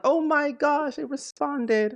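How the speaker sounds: high, drawn-out starstruck gushing; imitated fan freak-out. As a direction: act out a fan thrilled to get noticed. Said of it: "and they freak out".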